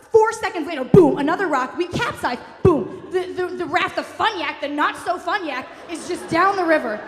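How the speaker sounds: usual speaking voice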